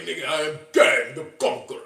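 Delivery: a hint of a deep Kang the Conqueror voice. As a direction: proclaim yourself like a conqueror.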